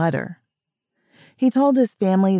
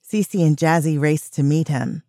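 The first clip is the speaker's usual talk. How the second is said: ordinary delivery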